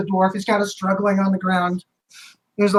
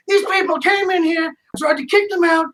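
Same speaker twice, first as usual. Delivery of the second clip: much higher, shouting whiny complaint; a rattled barman yelling his grievance; bartender complaining; 9 semitones higher than usual. Acted. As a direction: bartender shouting to the guard about the fight. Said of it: "He's like".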